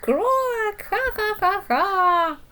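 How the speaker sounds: high, loud frog laughter; croaking laugh. Acted. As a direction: cackle like a giggling frog. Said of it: "pond exploded with laughter".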